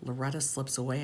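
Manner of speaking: usual speaking voice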